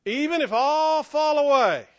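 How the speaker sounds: loud, high proclamation as Peter; bold declaration. Acted. Peter vowing loyalty to Jesus, boldly declaring. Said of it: "Peter declared".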